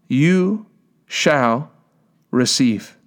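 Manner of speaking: "You ... shall ... receive", a pause after each word; word-by-word emphasis. Real, not acted. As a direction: set YOU, SHALL and RECEIVE apart as a weighty promise. Preacher drives home a promise.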